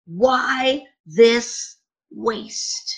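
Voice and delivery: each word stretched out, slowly; dragged-out rhetorical why; slow drawn-out question. Acted. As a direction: let the question hang, slowly. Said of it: "opening quote, dramatically slowed".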